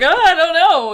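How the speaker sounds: laughing mock nonchalance; mock casual. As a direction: play it like nothing's wrong. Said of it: "casually like".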